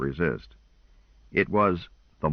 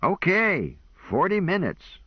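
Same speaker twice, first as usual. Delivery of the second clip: much higher, fed-up grudging okay; disgusted; 12 semitones higher than usual. A narrator's reading. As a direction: give in with disgust. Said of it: "he looked disgusted and said".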